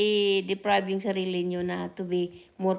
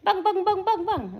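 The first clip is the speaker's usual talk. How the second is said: gunfire bangs; 10 semitones higher than usual. Retold or imitated. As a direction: fire off each bang like an Armalite. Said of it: "It's like Armalite ba?".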